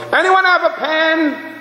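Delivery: high, drawn-out mock holler; hammed-up classroom yell. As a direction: holler it across the whole room. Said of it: "he yells".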